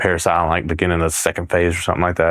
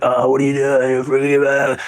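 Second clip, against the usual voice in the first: mock drill instructor; 5 semitones higher than usual. Drill instructors freaking out at recruit.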